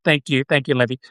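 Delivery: usual speaking voice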